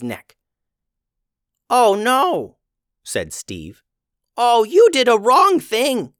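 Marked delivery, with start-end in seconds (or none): 1.7-2.6 high, louder goblin cry of alarm; a little goblin realizing real trouble. Acted. Goblin sees the pendant. 4.4-6.1 high, loud goblin voice, alarmed; a frightened goblin warning the wizard; alarmed goblin scolding. Acted.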